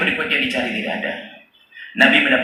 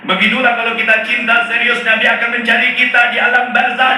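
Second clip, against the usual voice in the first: high, louder preaching climax; 10 semitones higher, 4 dB louder than usual. Real, not acted. Preacher urging the congregation, high and fervent. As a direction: drive the lesson home at full force.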